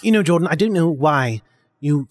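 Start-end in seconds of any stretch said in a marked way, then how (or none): none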